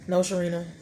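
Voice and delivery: normal talking voice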